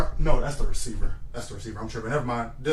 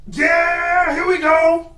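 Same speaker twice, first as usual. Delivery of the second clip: high, loud drawn-out snap count; quarterback cadence shout; 7 dB louder, much slower than usual. Pretend. Player calling cadence before a throw, high drawn-out shout. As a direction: holler it long and high like a quarterback.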